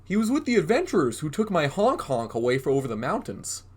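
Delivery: a mild troll telling news. Acted. Troll tells Goblin where his friend went, mild troll voice. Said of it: "said the troll".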